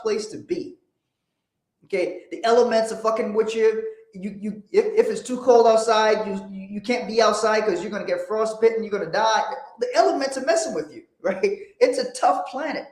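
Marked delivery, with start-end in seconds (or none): none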